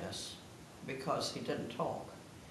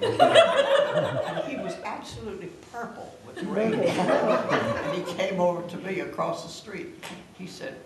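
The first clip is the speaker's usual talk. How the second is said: laughing; 3 semitones higher, 8 dB louder, slower than usual. Real, not acted. Laughing while retelling neighbor.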